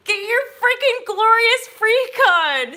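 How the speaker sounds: high, loud pep shout; rousing cheer. Real, not acted. Urging viewers to wake up and change, high and loud. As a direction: fire the viewers up, all in.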